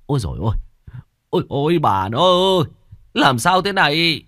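husband finding his fainted wife, panicked and loud; alarmed husband. Acted. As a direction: cry out in alarm over your wife.

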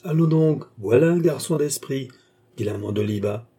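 ordinary delivery

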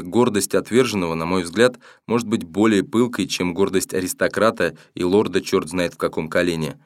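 ordinary delivery

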